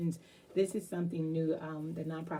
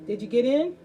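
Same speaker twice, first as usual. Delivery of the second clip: calling out; 6 semitones higher, 10 dB louder than usual. Real, not acted. Host calling to connecting pastor.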